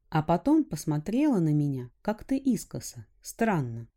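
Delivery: ordinary delivery, nothing marked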